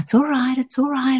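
high, gentle soothing of a mother-in-law. Retold or imitated. Sandy soothing her crying caller, gently high. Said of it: "she calmed me down so beautifully".

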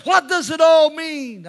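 high, loud, drawn-out mock plea; urgent pleading question. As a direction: cry it out slowly as the questioner. Acting out the ex-convict's urgent question.

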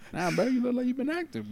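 talking through laughter, high; laughing while teasing. Real, not acted.

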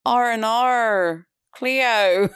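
drawled Australian "R and R", high and loud; Australian accent. Retold or imitated. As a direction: slowly mimic an Australian saying "R and R". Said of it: "like Australians say".

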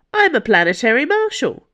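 loud and high, rushing at the end; indignant declaration. Acted. Marshal at gunpoint defending herself against accusation, indignant. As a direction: proclaim it forcefully, then speed up.

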